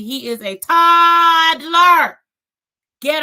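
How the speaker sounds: loud, high, drawn-out insistence; exasperated emphasis. Real, not acted. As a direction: belt it out slowly to drive the point home. Insisting the child is no baby.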